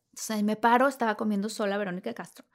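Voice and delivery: ordinary talk, nothing marked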